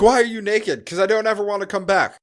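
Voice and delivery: normal talking voice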